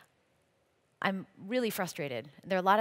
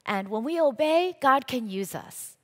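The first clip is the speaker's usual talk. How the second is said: louder, slow and weighty on God; preaching emphasis; 4 semitones higher, 6 dB louder than usual. Real, not acted. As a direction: proclaim it slowly to the congregation. Preacher driving home the point.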